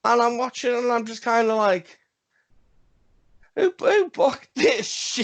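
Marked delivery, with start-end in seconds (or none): 3.6-5.2 high, drawn-out squeal of disbelief; mock-appalled, voice pitched way up; incredulous outburst. Viewer reacting to a baffling wrestling costume, incredulous.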